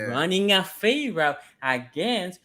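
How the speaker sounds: measured and drawn out; slow, deliberate repeat. Shown as a demonstration. Restating the fade route call for his co-hosts, slowly and deliberately. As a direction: lay it out slowly, phrase by phrase. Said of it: "let me say it slower".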